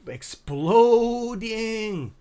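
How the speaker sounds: amazed, savour the word; loud slow astonished exclamation; excited drawn-out word. Real, not acted. Sees friend's channel blowing up.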